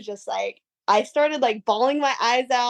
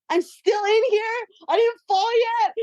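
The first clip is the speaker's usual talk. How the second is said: high, cracking cry of disbelief; half-shouted, laughing amazement; retold disbelief; 10 semitones higher than usual. Surfer reliving the barrel for the host, stunned high disbelief. Retelling shock inside the barrel.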